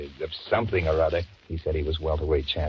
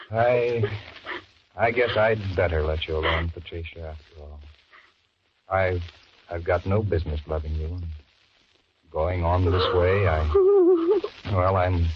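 hammed-up self-pity; 2 semitones higher, 6 dB louder than usual. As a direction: play the wounded fool. Hammy self-pitying confession.

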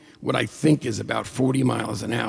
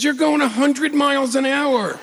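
alarmed shout; 13 semitones higher than usual. Retold or imitated. Co-driver alarmed at speed.

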